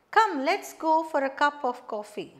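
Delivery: loud on "Come", descending to "coffee"; falling intonation. Shown as a demonstration. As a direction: start strong and let the tone fall through "coffee". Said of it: "Falling intonation".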